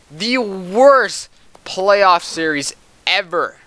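loud, slow, exasperated rant; raging. Real, not acted. Streamer raging at his team losing to Toronto, angry. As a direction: vent at the team, dragging the words. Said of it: "if I raged out".

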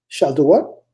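normal talking voice

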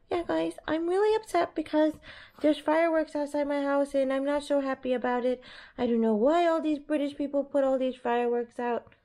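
high cutesy voice speaking as her upset dog; voicing the dog. Owner speaks for her dog upset by fireworks, high cutesy voice. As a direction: put words in the scared pup's mouth, high and sweet. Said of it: "Can you tell them why you're so upset?".

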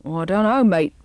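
lightly voiced terrier; a touch of a gruff dog's reply; barked reply. A narrator's reading. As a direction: bark back a loud, matey answer. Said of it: "barked back the Staffordshire".

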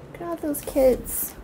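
ordinary talk, nothing marked

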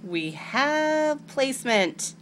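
slow, grand announcement; announcer voice. Real, not acted. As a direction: proclaim it like a big reveal. Triumphant announcement of decal placed.

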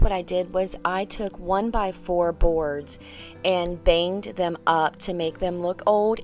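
ordinary talk

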